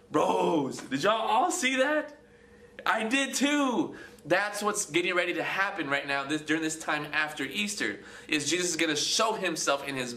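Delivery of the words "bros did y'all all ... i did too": amazed. Retold or imitated. Voicing disciples who all saw.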